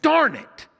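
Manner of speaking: high, exasperated Darn it; a comic grumble at being told to stop; annoyed grumble. Retold or imitated. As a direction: play the reluctant sigh of being interrupted. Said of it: "And I'm like".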